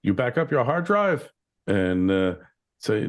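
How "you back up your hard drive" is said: IT guy question. Retold or imitated. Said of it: "And you go".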